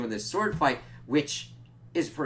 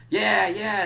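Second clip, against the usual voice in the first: gloating; 8 semitones higher, 7 dB louder than usual. Retold or imitated. Nandor gloating.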